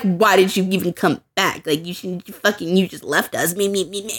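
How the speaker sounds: low, soft grumbling imitation; mocking mimicry. Friends complaining when she comes back, mockingly mimicked. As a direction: trail into dismissive mock nagging.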